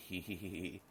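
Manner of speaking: acted hee-hee laugh; giggle. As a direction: giggle in a run of hees. Character giggles in reply.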